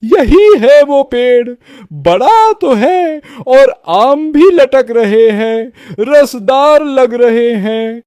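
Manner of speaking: drawn-out, relishing villain voice; greedy woodcutter. Acted. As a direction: size up the tree with greedy relish. (लालची लकड़हारे की आवाज़)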